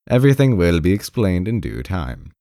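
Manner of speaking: usual speaking voice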